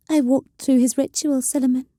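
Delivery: ordinary delivery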